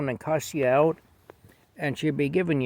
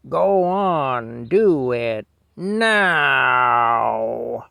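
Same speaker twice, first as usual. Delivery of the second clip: supervisor's roar; 9 dB louder, much slower than usual. Retold or imitated. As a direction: imitate a boss bellowing in someone's face. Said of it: "he'd roar in my face".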